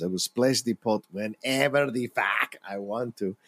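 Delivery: slow, mock Russian accent; Teddy K G B accent. Actor shows off the film character's line in a hammy Russian accent. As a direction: put on a thick, drawn-out Russian accent. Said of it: "about the Teddy K G B accent".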